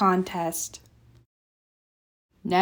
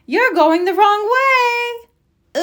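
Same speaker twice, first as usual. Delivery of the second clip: teacher calling; 12 semitones higher, 4 dB louder than usual. A narrator's reading. Teacher calling after Froggy.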